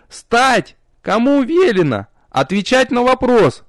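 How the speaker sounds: high yelled orders; shouting. A narrator's reading. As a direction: bark the commands. Marquise yelling at student.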